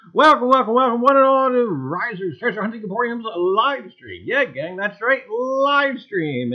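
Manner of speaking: loud, high showman's welcome; announcer opener. Acted. Host greeting his livestream viewers with a loud showman's welcome. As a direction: hype up the gang like a ringmaster. Host's booming stream opener.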